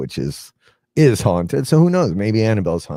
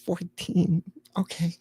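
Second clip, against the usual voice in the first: put-on little girl voice; 9 semitones higher than usual. Puppet Annabelle voice.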